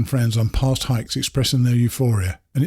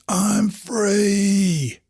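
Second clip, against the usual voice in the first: high, ecstatic shout into the wind; joyful scream; 8 semitones higher than usual. A narrator's reading. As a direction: yell it out with arms wide open. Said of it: "and screamed".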